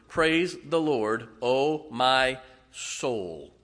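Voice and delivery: very slow, measured verse reading; solemnly spaced-out psalm line; deliberate scripture reading. Real, not acted. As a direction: read it word by word, lingering on each.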